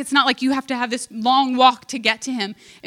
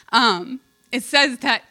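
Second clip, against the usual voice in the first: usual speaking voice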